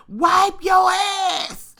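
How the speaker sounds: high, slow shout; screaming. Retold or imitated. Auntie screaming at the cousin in the bathroom, high slow shout. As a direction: stretch out the scream. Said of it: "she was screaming".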